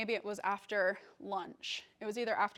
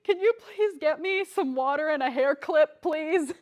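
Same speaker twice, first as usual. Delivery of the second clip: higher, louder call to a roommate; 6 semitones higher, 7 dB louder than usual. Retold or imitated. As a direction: call for help from the next room. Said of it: "I called out to my roommate".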